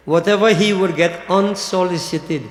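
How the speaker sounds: ordinary delivery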